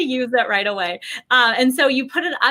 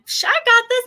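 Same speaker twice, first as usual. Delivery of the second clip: a much higher, scoffing psh; breezy mock confidence; cocky brush-off; 13 semitones higher, 4 dB louder than usual. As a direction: shrug it off like it's nothing. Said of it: "you're like".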